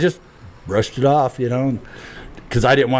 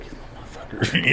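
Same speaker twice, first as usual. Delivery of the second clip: fast, much softer hissed whisper; whispered curse; 10 dB quieter, faster than usual. Retold or imitated. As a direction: whisper the insult through your teeth. Retold anger at his brother.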